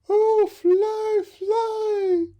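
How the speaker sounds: very high, loud desperate plea; begging mother bird. Acted. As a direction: beg the chick to fly. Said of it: "begged the mother bird".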